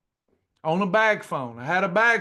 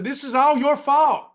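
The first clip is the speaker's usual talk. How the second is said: higher, louder angry outburst at mama; angry blaming; 6 semitones higher, 5 dB louder than usual. Retold or imitated. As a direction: snap the blame back at her, hammed-up. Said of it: "Boy, it made me mad".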